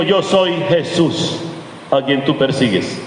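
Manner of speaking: low, slow, solemn voice of the Lord; voice of Jesús. Acted. Jesús answers Saulo on the road, low and slow.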